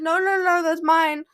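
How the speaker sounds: loud, high no no no; indignant shout of mine; shouted protest. Retold or imitated. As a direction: yell to grab back your hat. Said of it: "and I was like".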